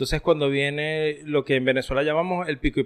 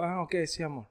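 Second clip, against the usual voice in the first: softer, offhand brush-off; dismissive murmur; 12 dB quieter than usual. Retold or imitated. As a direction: brush her off without turning. Imitates his own dismissive reply. (respuesta desganada y baja)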